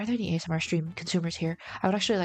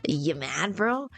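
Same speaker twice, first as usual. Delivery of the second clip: slow, taunting singsong with big pitch swoops; rage bait; slower than usual. Shown as a demonstration. Streamer doing peak rage bait audio on the mic, taunting. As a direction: needle them with drawn-out, swooping words. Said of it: "do the peak rage bait audio right now".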